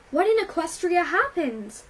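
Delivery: high, loud startled question; shocked exclamation. A narrator's reading. Twilight seeing the hurt filly at her door, shocked. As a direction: blurt it out in alarm.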